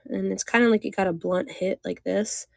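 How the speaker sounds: ordinary talk, nothing marked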